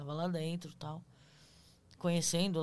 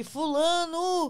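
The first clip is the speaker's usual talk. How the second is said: slow, higher, louder ghostly call; creepy modified voice; 9 semitones higher, 8 dB louder, much slower than usual. Retold or imitated. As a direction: call the name slowly like a ghost. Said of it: "colocou o áudio com modificador de voz".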